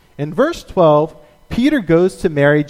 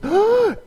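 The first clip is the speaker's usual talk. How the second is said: sharp high excited gasp; a startled thrilled gasp. Retold or imitated. Rhoda hearing Peter at the door, excited gasp.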